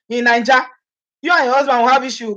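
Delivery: ordinary talk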